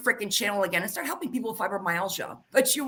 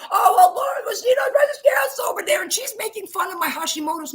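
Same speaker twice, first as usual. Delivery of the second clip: high, loud, wailing mock complaint; mock whining; 9 semitones higher, 6 dB louder than usual. Imitating a rival's victim story, mock tearful wail. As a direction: parody her crying about being mocked. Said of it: "you had there have them say".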